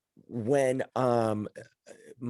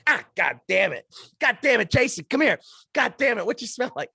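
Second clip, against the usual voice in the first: much higher, louder mock angry dad; hammed-up dad swearing and calling Jason; dad cursing; 9 semitones higher, 4 dB louder than usual. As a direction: ham up the disgusted father yelling for his son.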